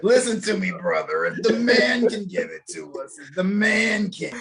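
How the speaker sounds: high, slow wrestler promo growl; Macho Man voice. Pretend. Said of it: "give us a voice".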